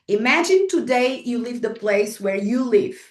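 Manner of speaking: normal talking voice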